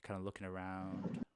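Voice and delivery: slow, low, hushed narration; suspenseful scene setting. Acted. Game master describing the emptied tent, hushed and ominous. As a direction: draw it out softly to build suspense.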